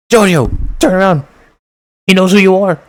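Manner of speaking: high, loud urgent yell; warning shout. Real, not acted. Viewer warning Jojo the man knows her, urgent yell.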